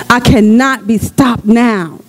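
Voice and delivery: slow, emphatic cannot; slow declaration. Shown as a demonstration. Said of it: "declare that".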